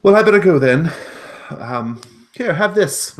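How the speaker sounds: high, loud, quick gnome, friendly; a chirpy gnome talking to a cat. Acted. Gnome leaves hurriedly.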